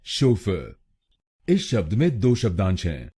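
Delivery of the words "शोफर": शोफर said with the weight on शो; first pronunciation. Shown as a demonstration. Teacher shows viewers the first way, SHO-fer. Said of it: "पहला है शोफर".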